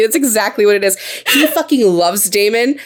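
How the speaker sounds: ordinary delivery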